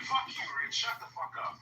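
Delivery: imitating an angry host shouting someone down; retold angry yell. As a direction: bark it back like the host losing it. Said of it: "And he's like".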